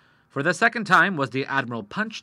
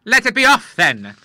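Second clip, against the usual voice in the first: loud defiant refusal; 10 semitones higher, 12 dB louder than usual. Acted. The Admiral refuses.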